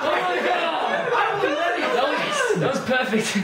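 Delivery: high, laughing cry of delight; squealing with laughter. Real, not acted. Delighted reaction to friend's line.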